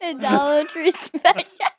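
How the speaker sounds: slow, high, laughing through it; cracking up while repeating it. Real, not acted.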